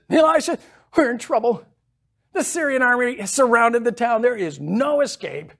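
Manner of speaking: high, loud frightened servant cry; panicked servant. Retold or imitated. Servant warning Elisha of the army, panicked cry. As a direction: play the servant bursting in alarmed. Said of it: "Servant comes in and says".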